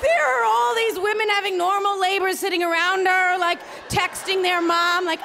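ordinary talk

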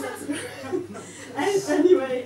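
usual speaking voice

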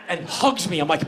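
normal talking voice

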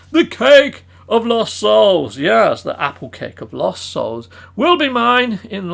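slow, ominous villain reading; evil cake name. Acted. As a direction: intone it like a dark spell. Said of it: "that evil frickin' cake".

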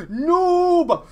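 loud, high taunting yell; jeering shout. Real, not acted. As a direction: yell the insult mockingly. Jeering at a kill stealer.

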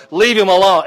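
dismissive king. Retold or imitated. King orders his men to leave the madman, dismissive.

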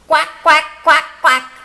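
high, loud quacks; duck quacking. Acted. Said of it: "cried Ping".

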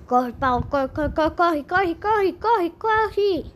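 rising excited run chant; while running. Pretend. Urging the game character on.

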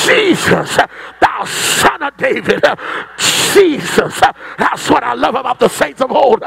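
driving gospel sermon cadence; full-force preaching. Acted. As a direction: preach hard, punching the end of each phrase.

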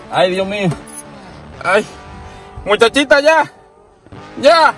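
high, loud panicked cries to stop; alarmed yelling. Real, not acted. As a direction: shout "ya" in alarm.

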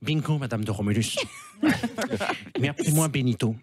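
low, menacing dictator voice; low and pompous, like a tyrant; Laurent Wauquiez impression. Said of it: "Tiens, Laurent Wauquiez".

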